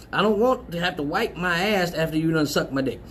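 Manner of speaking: ordinary delivery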